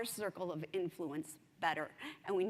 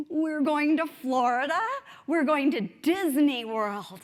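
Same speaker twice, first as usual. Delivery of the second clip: put-on louder, eager kid telling his trip; excited kid; 7 semitones higher, 10 dB louder than usual. A boy tells his seatmate about Disney, eager and loud. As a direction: burst out with the news of the trip.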